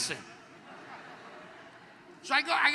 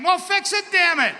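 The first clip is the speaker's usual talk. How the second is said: much higher and louder, fed up shouting; angry loud demand; 8 semitones higher, 7 dB louder than usual. Pretend. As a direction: yell it, fully fed up. Mock patient demanding fix again.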